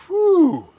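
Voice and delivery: sharp high exhale of heat; hot sauce gasp. Real, not acted. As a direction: blow out the burn. Mouth burning from hot sauce.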